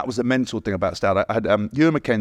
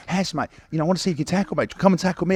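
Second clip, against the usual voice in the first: lightly voiced, higher teasing challenge; a hint of a challenging coach; 6 semitones higher, faster than usual. Coach challenging a player after session, higher teasing dare. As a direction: dare him to tackle like a cocky coach. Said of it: "he'd just get into me like".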